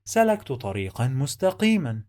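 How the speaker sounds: fast, joined-up reading of the sentence; quick connected model reading; fluent reading. Shown as a demonstration. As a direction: run the words together fluently. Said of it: "Let's read the sentence one more time".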